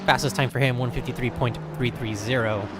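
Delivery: ordinary talk, nothing marked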